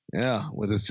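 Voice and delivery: ordinary talk, nothing marked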